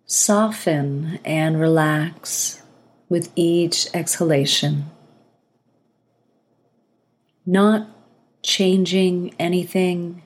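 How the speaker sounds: calm breathing-exercise guidance, spaciously paced; guided meditation. Acted. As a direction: ease the listener into relaxing, phrase by phrase.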